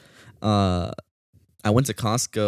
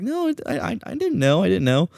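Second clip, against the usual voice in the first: much higher, flustered denial; flustered girlfriend; 9 semitones higher than usual. Retold or imitated. Girlfriend insisting she didn't know, high flustered.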